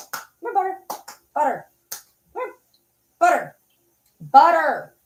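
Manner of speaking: a high pet-talk call to Butter; high dog-calling voice. Real, not acted. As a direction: coax the dog over in a high voice.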